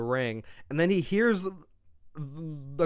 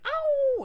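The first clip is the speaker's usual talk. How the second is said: very high mock yelp of pain. Retelling Solo's scream, a very high mock yelp. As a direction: squeak the ow up high. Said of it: "'cause he goes like".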